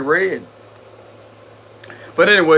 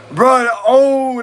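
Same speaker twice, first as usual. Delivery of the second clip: higher, louder sudden exclamation; surprised; 7 semitones higher, 5 dB louder than usual. Real, not acted. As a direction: caught off guard by the card. Sees a good tarot card.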